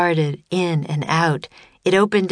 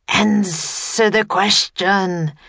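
slow, stretched monster command; booming serpent voice; 3 semitones higher, slower than usual. A narrator's reading. Serpent pressing the children for an answer, slow booming voice. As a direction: drag the order out with a long S. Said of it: "the serpent boomed".

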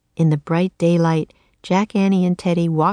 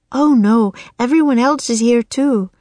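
put-on girl voice; 6 semitones higher, 4 dB louder than usual. Castle girl answers the children.

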